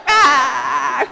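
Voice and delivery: high, loud mock wail of a sobbing friend; baby cry wail. Friend Puga runs to the altar, hammed-up loud crying wail. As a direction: wail like a baby running forward. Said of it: "crying like a baby".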